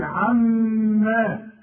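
"عما" with the doubled meem held long through the nose; nasalized doubled meem. Shown as a demonstration. Teacher showing the nasal doubled meem in "عما", drawn out. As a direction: hum the meem in "عما" long before the vowel. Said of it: "والميم انت عارف لازم تغم ما دام مشددة".

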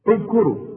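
اذكروا with a rounded u on the first hamza; pronunciation demo. Shown as a demonstration. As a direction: say اذكروا with a clear u at the start. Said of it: "أنا بدأت وقلت اذكروا". (نطق اذكروا بضم الهمزة)

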